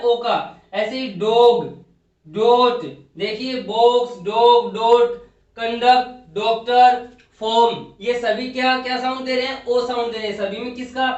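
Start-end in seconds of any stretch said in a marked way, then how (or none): 0.7-8.5 each word बॉक्स, डॉग, डॉट, कंडक्ट, डॉक्टर punched loud with an open ओ; emphatic word demos. Shown as a demonstration. Teacher drilling the class on the ओ words, loud. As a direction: call out each word with a clear ओ. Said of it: "अब देखिए क्या आ रहा है".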